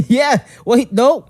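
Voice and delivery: ordinary talk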